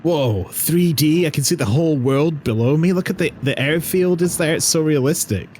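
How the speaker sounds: mock amazed kid. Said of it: "and it was like".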